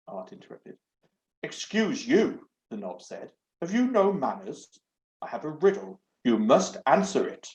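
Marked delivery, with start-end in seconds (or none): 1.4-2.5 slow, offended rebuke; drawn-out affronted retort; indignant. Acted. Offended knob rebukes Art. 3.6-7.5 a prim, fussy talking doorknob; lightly voiced haughty door knob. Doorknob voice held.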